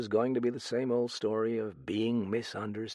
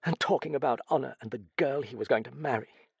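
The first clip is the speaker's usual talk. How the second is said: high-pitched, quickened confession; distraught heroine, bitter; 5 semitones higher, faster than usual. Acted. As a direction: rush on with the painful story.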